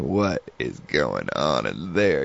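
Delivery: low, slow, soft and suspicious, as if peering into a sinister building; hushed spooky wonder. Retold or imitated. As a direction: murmur it like you're imagining something sinister inside. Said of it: "I was just thinking".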